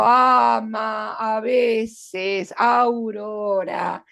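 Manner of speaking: "ama" y "aurora" muy lentos, alargando las vocales; vocales marcadas. Shown as a demonstration. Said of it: "Si hubieran sido vocales". (marca despacio las vocales iniciales de "ama, a veces, aurora")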